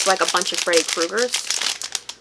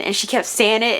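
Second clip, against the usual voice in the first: usual speaking voice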